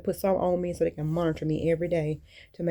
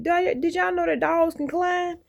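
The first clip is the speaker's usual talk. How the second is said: much higher amazed question; retold amazement at climbing dogs; 10 semitones higher than usual. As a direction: ask it high, in disbelief. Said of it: "I'm like".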